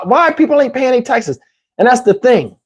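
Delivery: ordinary delivery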